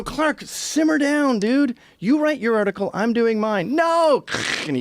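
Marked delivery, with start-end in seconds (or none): 0.0-3.7 slow condescending calm. Retold or imitated. Acted quarrel, calming Clark. 3.7-4.2 high whiny refusal. Retold or imitated. Clark refuses.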